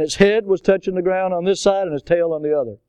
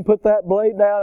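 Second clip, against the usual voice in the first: normal talking voice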